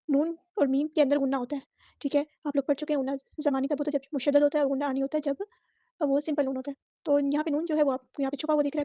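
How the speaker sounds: usual speaking voice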